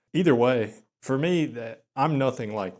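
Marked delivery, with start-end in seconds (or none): none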